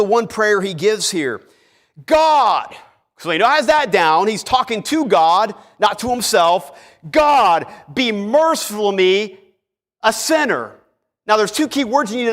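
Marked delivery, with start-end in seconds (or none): 2.1-2.7 high, loud cry to God; pleading cry. Acted. Sinner calls out to God, high and loud. 7.1-10.8 earnest plea. Acted. Sinner's plea voiced.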